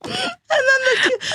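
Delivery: words breaking into high laughter; laughing. Real, not acted. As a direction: crack up mid-sentence. Laughs retelling daughter sitting on boy.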